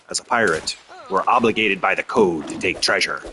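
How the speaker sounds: a hint of a hammy pirate. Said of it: "doing a stupid pirate voice".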